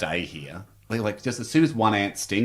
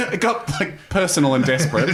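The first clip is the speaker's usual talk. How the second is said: high laughter through the words; laughing; 4 semitones higher, 3 dB louder than usual. Real, not acted. Telling cohost about pleading with the chief, laughing. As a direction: laugh while telling the desperate bit.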